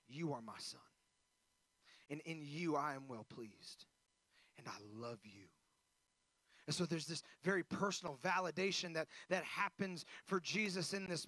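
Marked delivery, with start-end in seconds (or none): 0.1-5.5 a father's intimate blessing, hushed and loving; tender father. Retold or imitated. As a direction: speak gently to your own son, close and personal. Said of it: "he looks at his Son and goes".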